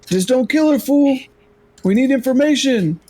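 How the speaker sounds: high, urgent call to a teammate; in-character order. Acted. Simone telling a fellow fighter to spare captive, high acted call.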